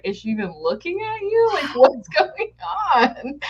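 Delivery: high laughing what's going on; incredulous through giggles; laughing at absurd complaint; laughing disbelief. Retold or imitated. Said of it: "And I was like".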